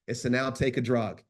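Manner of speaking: usual speaking voice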